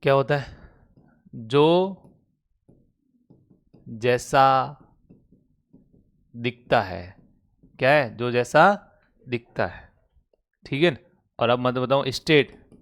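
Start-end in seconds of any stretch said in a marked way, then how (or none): 1.3-7.2 word by word with long pauses; slow dictation. Real, not acted. Teacher dictating a definition to students, slow dictation. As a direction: dictate it slowly, leaving time to write.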